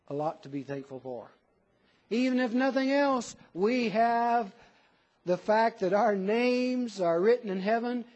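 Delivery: normal talking voice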